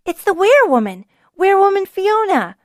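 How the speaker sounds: put-on high, loud excited children's cry; squealing little ones spotting her. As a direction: call it out like thrilled kids.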